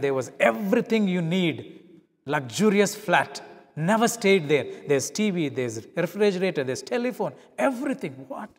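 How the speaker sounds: high, loud listing of wonders; amazed delight. Retold or imitated. Preacher recalling his awe at the luxurious flat, excited. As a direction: marvel at each luxury.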